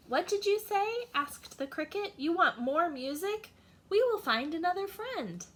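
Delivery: high, eager little cricket voice; cheerful cricket. Acted. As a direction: pipe it up, delighted to oblige. Cricket answers again.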